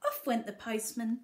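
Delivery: ordinary delivery, nothing marked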